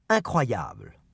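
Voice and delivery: three even beats with the stress on the final syllable; model phrases for repetition. Shown as a demonstration. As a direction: clear drill delivery with the weight on the last syllable. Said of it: "en faisant bien attention au rythme et à l'accent tonique".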